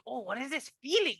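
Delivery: acted wonder. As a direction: voice a sudden curious inner stirring. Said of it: "It, like, feels like".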